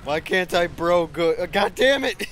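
loud, high exasperated outburst; frustrated yelling. Real, not acted. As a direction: rage at the screen. Raging at the game.

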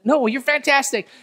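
high, loud, pleading praise; insisting compliment. Retold or imitated. Friend insisting on the compliment, high and loud.

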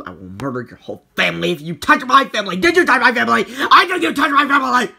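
rising into loud fast yelling; angry threat. Acted. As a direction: build from threat to furious shout. Threatening the ghost.

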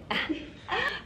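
bright laugh. Real, not acted. Laughs at own joke.

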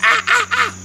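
short high monkey shrieks; high, repeated "ah" screeches. Retold or imitated. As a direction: imitate a monkey's sharp cries. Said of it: "and the monkey went".

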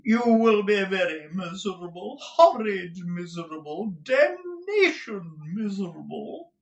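high-pitched melodramatic wail; affected Mantalini character. A narrator's reading. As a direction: foppish husband hamming up the misery, stretching the end. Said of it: "said Mister Mantalini".

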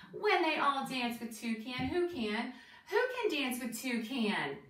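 lilting, deliberate rhyme reading; reading to children. Acted. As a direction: read a bouncy rhyme to young listeners, questions lifted at the end. Reads picture book to children.

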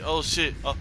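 ordinary delivery

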